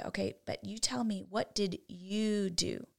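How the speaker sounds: soft, measured parent questioning. Acted. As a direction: press the question gently and quietly. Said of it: "I'm going to lower my voice".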